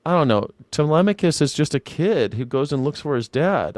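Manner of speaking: ordinary talk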